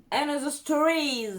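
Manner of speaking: put-on playful English-accented Another Stories. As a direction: show off a posh English accent on the brand name. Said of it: "Vous avez vu l'accent?".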